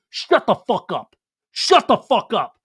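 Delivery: slow, punched-out imitation of yelling; acted-out fury, drawn out; retold angry outburst. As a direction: hit each word as in a mock row. [name] repeats outburst in acted quarrel.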